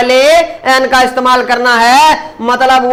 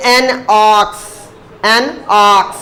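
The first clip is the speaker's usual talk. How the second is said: slow demo reading; 3 semitones lower, much slower than usual. Shown as a demonstration. Teacher reading an example aloud, slow and clear. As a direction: slowly read an ox, word by word. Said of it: "अब आगे देखिए".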